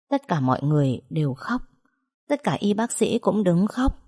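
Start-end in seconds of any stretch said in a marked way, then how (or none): none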